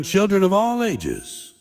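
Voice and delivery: slow, lifted ringmaster announcement; booming ringmaster. Pretend. Comedian mocking a ringmaster announcing to the crowd, slow and booming. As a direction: stretch it out like a lightly hammed big-top host. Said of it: "with a booming voice going".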